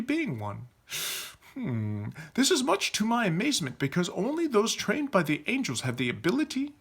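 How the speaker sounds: high, formal, wondering voice; musing knight leader. Acted. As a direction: ponder aloud in stately puzzlement. Leader's character voice.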